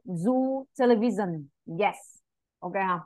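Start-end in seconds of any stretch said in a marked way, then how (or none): 0.1-1.5 "Zoo television" with a slow, buzzing Vietnamese northern G. Shown as a demonstration. Teacher demonstrating the Z sound to a student, slow and buzzing. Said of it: "Giống như G ngoài miền Bắc ấy".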